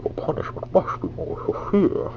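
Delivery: whispered villain retry; hoarse-whisper impersonation of a masked villain; Bane. Shown as a demonstration. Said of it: "let me try that again".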